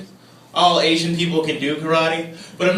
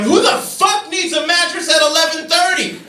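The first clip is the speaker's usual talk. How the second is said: high, indignant outburst; exasperated rant; 10 semitones higher, 4 dB louder than usual. Retold or imitated. Hungry comedian outside the only open mattress store, exasperated. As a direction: rant it at the empty street in disbelief. Retells ranting in the street, hungry.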